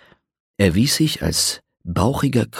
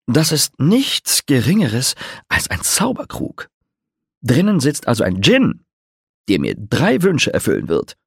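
higher, slowish voice of a vain troubadour; boastful bard; 5 semitones higher than usual. A narrator's reading. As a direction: show off the magic jug with grand glee.

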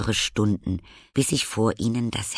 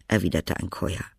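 ordinary delivery

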